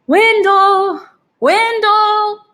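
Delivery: high, loud call across the lawn; yelling a name. Acted. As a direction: call out hands cupped round the mouth.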